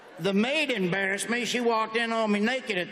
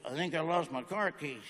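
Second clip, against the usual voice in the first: low, quiet voice for a helpless man; subdued mock reply; 6 semitones lower, 7 dB quieter than usual. Man in neck halo answering, quiet and low. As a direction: say it small and low, acting hurt.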